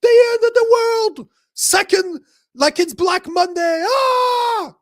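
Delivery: high, loud hammed-up freakout; all excited panic. Mocking his own panic to a co-host, a high hammed-up shriek. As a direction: shriek it like the world is ending. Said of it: "All excited".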